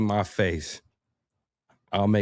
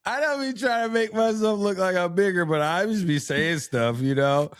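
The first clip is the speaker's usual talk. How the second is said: laughing while talking; 11 semitones higher than usual. Real, not acted.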